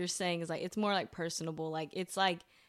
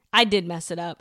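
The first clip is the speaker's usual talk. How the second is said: usual speaking voice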